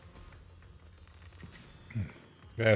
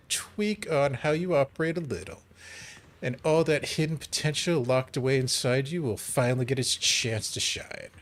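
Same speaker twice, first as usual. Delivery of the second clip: ordinary talk, nothing marked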